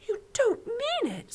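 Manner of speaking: put-on high, upset child voice; girl horrified by the giant's tale; shocked little girl. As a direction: cry out in disbelief. Sophie shocked.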